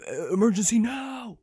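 mild urgent army voice; army guy line. Retold or imitated. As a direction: shout a panicked warning to Sarge. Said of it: "army guy's like".

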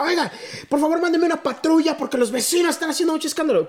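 vecino quejumbroso con voz aguda; modito golpeado. Retold or imitated. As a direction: pide la patrulla con tono exigente. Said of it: "tenía moditos golpeados". (el vecino pidiendo patrulla, con modito golpeado)

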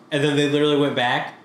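ordinary talk, nothing marked

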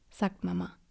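usual speaking voice

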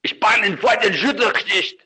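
high, loud fake German rant. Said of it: "my fake German sounded authentic".

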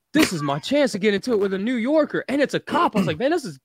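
high, thrilled retelling; gleeful excitement. Retold or imitated. Tourist spoiling for a fight with a New Yorker, gleeful. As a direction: relish it like a long-awaited chance. Relishing chance to fight cop.

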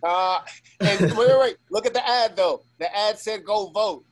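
loud, high, animated cut-in; excited interjection. Real, not acted. Guest interrupts host about the ad that popped up, excited.

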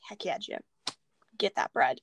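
understated under-the-breath cheer; quiet cheer. Retold or imitated. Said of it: "I'm like".

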